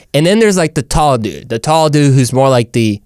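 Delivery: ordinary delivery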